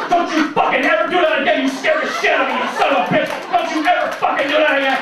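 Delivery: loud, high yelling mom; a mother's loud, high screaming; furious mom. Retold or imitated. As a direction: scold him at full shout, loud and high.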